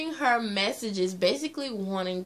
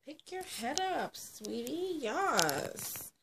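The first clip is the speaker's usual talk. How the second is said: cooing; 6 semitones higher, 4 dB quieter, slower than usual. Real, not acted. Vlogger coaxing the camera angle, soft cooing. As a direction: coax it gently like to a little one. Coaxing her wig or pet-like talk.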